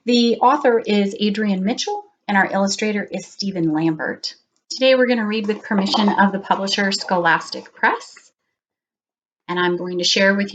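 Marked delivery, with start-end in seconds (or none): none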